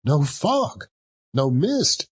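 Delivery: plain reading voice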